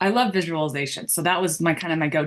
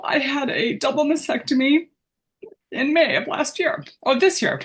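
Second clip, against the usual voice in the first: choked up; 6 semitones higher than usual. Real, not acted. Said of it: "I get a little emotional".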